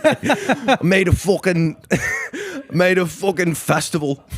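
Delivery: slow, high laughing mock hype; imitating Young Blood. Still mocking Young Blood's festival brag, laughing. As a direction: hype it up while cracking up. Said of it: "And he's like".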